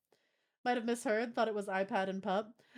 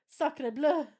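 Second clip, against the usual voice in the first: put-on French accent; 5 semitones higher, 7 dB louder than usual. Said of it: "we are doing the French accent now?".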